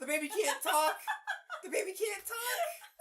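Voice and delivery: high, loud cry through laughter; laughing disbelief. Real, not acted. Mocking the choking video, high laughing outburst. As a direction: shout it while cracking up.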